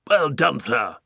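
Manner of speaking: put-on loud hearty old Duke; Duke shouting. Duke cheering the pelican, loud hearty shout. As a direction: boom out the praise. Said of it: "shouted the Duke".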